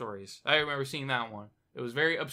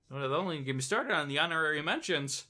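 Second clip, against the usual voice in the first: ordinary talk, nothing marked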